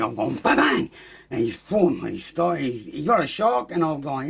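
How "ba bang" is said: mock punch noise. Mimicking punches in fight story.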